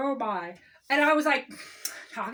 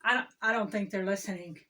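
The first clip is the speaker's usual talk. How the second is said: usual speaking voice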